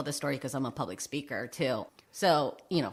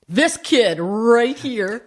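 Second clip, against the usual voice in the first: slow, loud, higher coach voice picking her out; impressed coach; 7 semitones higher, 10 dB louder, much slower than usual. Retold or imitated. Gym teacher spotting the dodgeball star, slow loud proud call. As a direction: spell out each word like a coach who found his star. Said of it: "was like".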